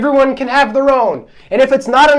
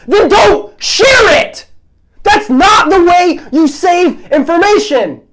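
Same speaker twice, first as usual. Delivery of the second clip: slow, loud, high exasperated shout; real fired-up outburst; fired-up yell; 7 semitones higher, 4 dB louder, slower than usual. Real, not acted. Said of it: "I'm getting so fired up".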